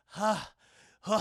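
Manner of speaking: imitated worn-out panting; exhausted. Both fighters after hours of dueling, exhausted. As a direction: heave heavy mock-tired breaths. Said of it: "exhausted. They're going".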